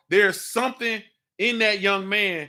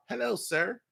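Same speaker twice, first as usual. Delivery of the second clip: mocking meek greeting; 7 dB quieter than usual. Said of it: "you talking about".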